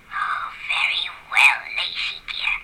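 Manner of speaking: very low, slow whispered sigh of surrender; grudging whisper. Acted. Baboon reluctantly agrees, low whispered grumble.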